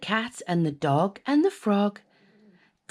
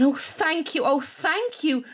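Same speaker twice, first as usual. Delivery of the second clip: high, heartfelt thanks; grateful; 7 semitones higher than usual. Acted. Witch thanking the beast, high heartfelt thanks. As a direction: gush with gratitude. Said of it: "the grateful witch cried".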